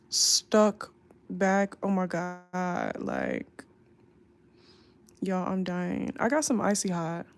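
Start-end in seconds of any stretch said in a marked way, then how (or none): none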